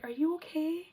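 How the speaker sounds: a touch of concerned high voice; concerned owners. Retold or imitated. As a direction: gently ask if she is okay. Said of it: "they were like".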